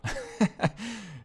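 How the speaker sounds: high, bright burst of laughter; short laugh. Real, not acted. Amused by the simple code, a quick high laugh.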